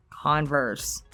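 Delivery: ordinary delivery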